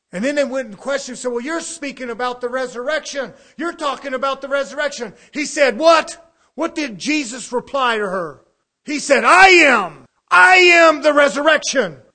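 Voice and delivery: loud, driving preacher's cadence; full-force preaching. Acted. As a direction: hammer the point home to the congregation. Preacher acting out the Bible scene.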